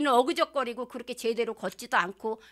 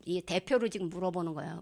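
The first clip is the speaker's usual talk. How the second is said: normal talking voice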